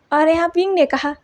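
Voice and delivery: ordinary delivery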